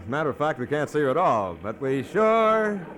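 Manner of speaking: ordinary delivery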